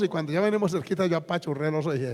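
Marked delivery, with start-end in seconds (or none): none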